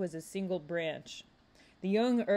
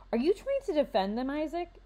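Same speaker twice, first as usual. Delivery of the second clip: assertive; 8 semitones higher than usual. A narrator's reading. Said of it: "Xavier said in an assertive tone".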